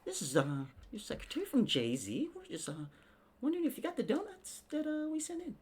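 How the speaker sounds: high, sweet mock secretary. Podcaster mocking [name]'s secretary, high polite voice. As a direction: put on a prim office voice. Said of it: "have a secretary call you".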